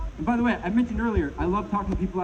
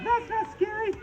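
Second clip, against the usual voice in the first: put-on child's voice; slower than usual. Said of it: "kids are laughing".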